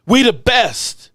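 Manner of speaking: high, drawn-out swaggering brag; chest-out team bravado; cocky boast. Acted. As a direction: crow it like the champs. Boasting as the Cowboys.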